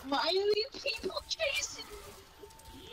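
being chased. Real, not acted. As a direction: plead with the chasers.

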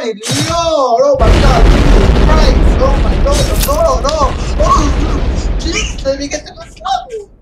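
high, loud, building panic; frantic pleading yell. Real, not acted. Gamer begging to reach the level, frantic pleading yell. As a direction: wail it out, begging the game.

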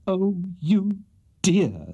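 high, adoring coo; adoring widow, overcome; smitten gushing. A narrator's reading. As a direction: gush at the man you adore. Smitten widow gushes at Pickwick.

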